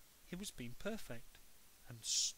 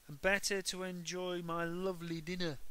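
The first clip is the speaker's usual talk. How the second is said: sweet Henry; 4 semitones higher, 4 dB louder than usual. A narrator's reading. Henry acting perfect.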